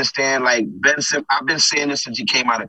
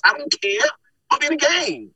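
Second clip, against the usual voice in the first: insisting; 12 semitones higher than usual. Retold or imitated. Retold argument with coach.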